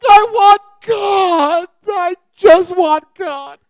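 hammed-up loud, high bawling; mock crying wail. Man bursting into tears on a plane, hammed-up wail. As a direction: wail it slowly like a sobbing child. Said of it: "I started crying".

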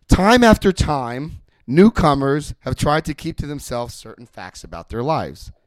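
slow, loud, weighty book reading; solemn reading. Retold or imitated. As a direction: read it like scripture, slow and loud. Sponsor solemnly reads the book.

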